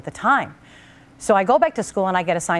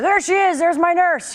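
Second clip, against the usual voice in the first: dad's voice pitched much higher and louder, beaming; proud dad; 11 semitones higher, 4 dB louder, slower than usual. Retold or imitated. A dad showing off his daughter's nursing cap, proud and high. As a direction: show off your daughter to the guys.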